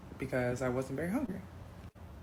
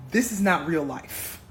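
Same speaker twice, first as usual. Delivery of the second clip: louder, slow, fed up; disbelieving anger; 6 dB louder than usual. Retold or imitated. Telling off her date, loud slow disbelief. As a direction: flatly refuse the nonsense. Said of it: "I said".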